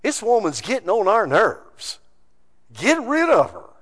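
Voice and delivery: mildly exasperated disciples, lightly voiced; annoyed disciples. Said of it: "notice what their standard is".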